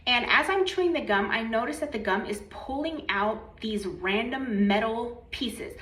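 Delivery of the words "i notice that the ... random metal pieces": usual speaking voice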